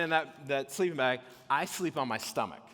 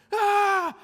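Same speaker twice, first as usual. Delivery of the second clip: one high, loud scream; night-terror scream; 10 dB louder than usual. Retold or imitated. Tentmate with night terrors, screaming. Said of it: "And he's screaming".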